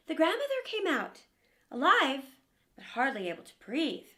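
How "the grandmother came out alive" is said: ordinary delivery, nothing marked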